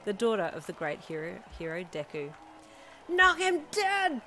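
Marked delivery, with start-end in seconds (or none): 3.1-4.2 high, loud, drawn-out yell; shouted cheer. A narrator's reading. Best friend cheering from the stands, shouting high. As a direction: yell slow encouragement at full voice. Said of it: "Kiro shouted".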